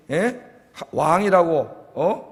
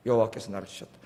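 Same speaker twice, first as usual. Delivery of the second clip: 여호와께서 나를 치셨다 said much lower and softer; soft echo; 9 semitones lower, 10 dB quieter, faster than usual. Shown as a demonstration. Preacher repeating Naomi's words to the church, soft. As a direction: repeat it softly to yourself.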